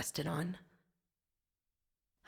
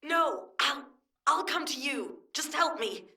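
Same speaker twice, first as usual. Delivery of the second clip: high, strained and breathless; gasping, urgent insistence; desperate plea; 8 semitones higher than usual. Acted. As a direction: beg for help through the pain. Pleading for help while poisoned.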